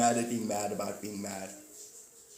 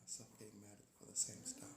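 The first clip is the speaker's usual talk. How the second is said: very soft unvoiced whisper; 3 semitones lower, 20 dB quieter, faster than usual. Real, not acted. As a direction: breathe it out, barely audible. Whisper continues.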